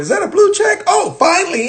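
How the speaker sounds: high, excited disbelief; surprised. Retold or imitated. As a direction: gasp it out in delight. Discovering verification.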